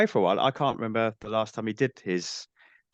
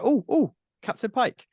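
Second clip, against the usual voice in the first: much higher, fan-squeal of delight; excited recognition; 13 semitones higher, 4 dB louder than usual. Retold or imitated. Strange New Worlds fan recognising Captain Pike, excited. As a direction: spot a beloved star and squeal his name. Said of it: "I was like".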